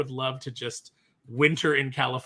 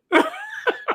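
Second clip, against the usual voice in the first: high-pitched laugh; 5 dB louder than usual. Real, not acted. Host laughing while searching.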